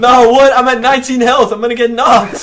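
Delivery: panicked. Real, not acted. Low health in a storm.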